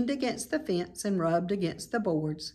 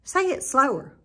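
piglet voice; 6 semitones higher, 4 dB louder than usual. A narrator's reading. Wilbur asks goose.

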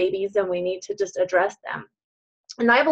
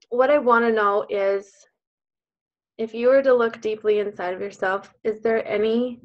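normal talking voice

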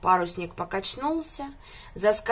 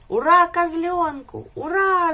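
much higher, louder, slow cheering; 9 semitones higher, 8 dB louder, slower than usual. A narrator's reading. Animals cheer the goat, high and loud. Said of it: "закричали все тут в один голос".

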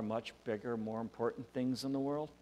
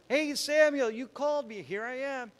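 put-on very high, loud, excited child; young boy Eli; 13 semitones higher, 8 dB louder than usual. Boy running to wake the priest, very high excited call. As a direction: pipe up eagerly like a boy running in. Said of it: "He runs over to Samuel".

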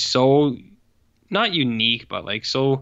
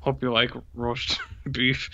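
slow, quieter line broken by a laugh; smiling villain imitation; 4 dB quieter, slower than usual. Echoing the villain line to his cohost, smiling and laughing. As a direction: grin through it like the villain, laughing mid-line. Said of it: "he says it with a smile".

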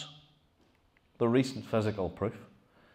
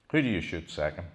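ordinary talk

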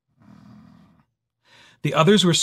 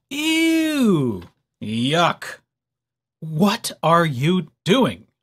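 disgusted; much slower than usual. Acted. Mole rats disgusted at Wilbur.

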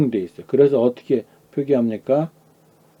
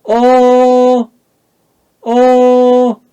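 어 held high, loud and level; high first tone; 12 semitones higher, 9 dB louder, much slower than usual. Shown as a demonstration. Teacher drilling the next syllable, 어 high and level. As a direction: sustain 어 on one high note. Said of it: "그 다음 거".

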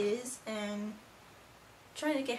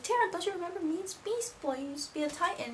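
higher-pitched mock plea to an old friend; urgent pleading; 7 semitones higher than usual. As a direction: plead with her to remember, pitched up. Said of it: "And he's like".

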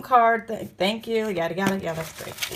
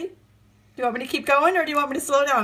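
ordinary talk

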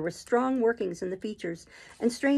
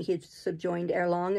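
plain reading voice

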